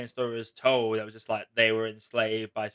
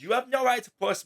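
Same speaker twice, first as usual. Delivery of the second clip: high-pitched mock indignation; imitated snapping; 11 semitones higher than usual. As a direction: imitate a nice person finally snapping. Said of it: "finally just snaps and just says".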